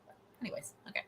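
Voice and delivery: whispered, shaking it off; soft unvoiced aside to move on. Real, not acted. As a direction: breathe it out as a whisper. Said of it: "getting emotional".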